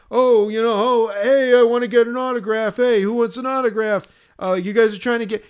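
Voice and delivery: loud, high-pitched heckler holler; blaring, pushy foghorn voice; yelling drunk woman. Retold or imitated. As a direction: imitate a belligerent drunk shouting at strangers. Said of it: "she was just yelling".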